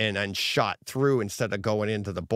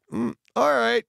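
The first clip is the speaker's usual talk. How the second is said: mock self-scolding; 6 semitones higher than usual. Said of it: "I was like".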